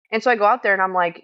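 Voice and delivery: ordinary delivery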